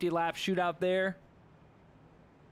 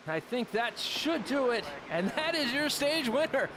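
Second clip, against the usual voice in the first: brisk, raised play-by-play call; live race play-by-play; 7 semitones higher than usual. Acted. Streamer calls the race lap for viewers, lively play-by-play.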